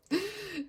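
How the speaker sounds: sudden high laugh at herself; burst of laughter. Real, not acted. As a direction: laugh out at how much it was. Laughs at their own excess. (éclat de rire)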